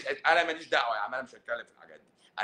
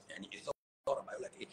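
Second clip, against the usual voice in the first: playful whisper; 7 semitones lower, 14 dB quieter, faster than usual. Pretend. Doctor teasing his audience about his memoir, whispered. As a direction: breathe it, a hushed teasing aside.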